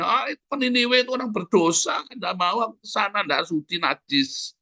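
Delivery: high-pitched haughty refusal; mock disdain, pitched well up; arrogant. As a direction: act out a prophet scorning sinners. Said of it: "dalam kesombongannya".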